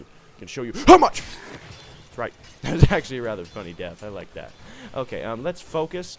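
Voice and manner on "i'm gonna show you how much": high, loud hammed-up threat; mock threat yell. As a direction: yell it as he attacks. Threatening a zombie.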